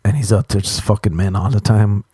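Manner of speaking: ordinary talk, nothing marked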